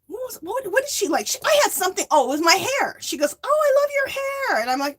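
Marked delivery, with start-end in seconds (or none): 3.4-4.6 lady's sweet compliment voiced much higher; a light high put-on voice for the stranger; imitating the lady. Retelling the bank lady, pitched high and sweet.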